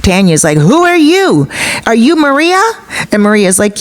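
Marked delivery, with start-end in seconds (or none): 0.6-2.6 high, agitated demand; retold indignant questioning, pitched up; having a conniption fit. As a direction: imitate an outraged girlfriend confronting a stranger. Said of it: "having a conniption fit".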